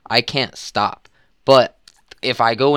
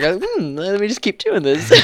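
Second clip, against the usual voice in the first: dopey dog voice, higher; 9 semitones higher, slower than usual. Pretend. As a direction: voice a confused dog. Voicing a dog with gum.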